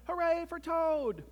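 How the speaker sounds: high, drawn-out hooray; storybook frog's high cheer; shouted cheer. A narrator's reading. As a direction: cheer loudly and slowly for the star. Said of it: "but he shouted".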